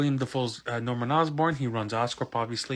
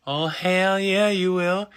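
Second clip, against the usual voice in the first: high, loud, drawn-out cheer; hyped reaction; 7 semitones higher, 5 dB louder, much slower than usual. Retold or imitated. Fan answering the villain on screen, loud and hyped.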